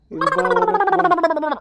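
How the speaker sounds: long, high, drawn-out shout; calling out. Acted. Drunk husband hollering for his wife to come out. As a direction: bellow it slowly across the house.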